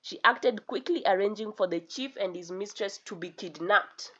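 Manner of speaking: ordinary delivery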